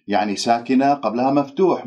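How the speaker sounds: ordinary delivery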